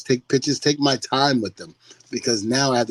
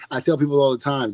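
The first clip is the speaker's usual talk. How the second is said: ordinary delivery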